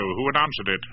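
usual speaking voice

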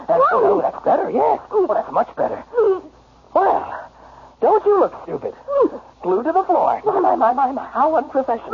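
high, gleeful taunting; mocking gloat. Husband jeers at his glued-down wife, mocking. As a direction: gloat with mock approval.

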